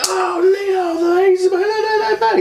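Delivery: very high-pitched imitation of grandma screaming; mock a frantic grandma's shriek; retold screaming. Imitating grandma screaming about an intruder at night, frantic high shriek. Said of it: "I hear my grandma screaming".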